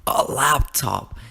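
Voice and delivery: slow, drawn-out delighted exclamation; big, savoured reveal of "laptop"; reenacted excitement. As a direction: stretch out "laptop" in thrilled disbelief. Reliving childhood joy at the laptop.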